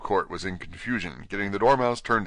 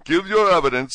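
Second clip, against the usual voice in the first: loud, high royal order; raised, commanding voice; King's command; 9 semitones higher, 8 dB louder than usual. Acted. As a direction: demand it from the bench. King orders the cook to testify.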